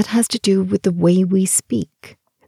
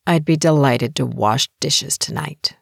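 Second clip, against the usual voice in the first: a mild mock snarl, understated sarcasm; lightly sour, begrudging; a hint of a snarl; 2 semitones lower than usual. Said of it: "said in a snarling tone".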